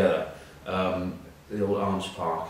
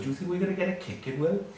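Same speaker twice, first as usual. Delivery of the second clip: giggly teammate; 10 semitones higher than usual. Retold or imitated. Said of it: "I suddenly hear this giggling".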